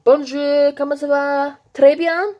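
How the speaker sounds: high, sing-song French hello; bright French greeting. Pretend. Showing viewers her French, high sing-song. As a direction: chirp the French like a tourist.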